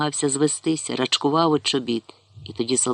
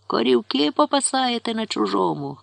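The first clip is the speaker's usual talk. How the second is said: higher, scolding shout; angry rider shouting; 8 semitones higher than usual. A narrator's reading. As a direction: yell at the herders from horseback. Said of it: "кричав вершник".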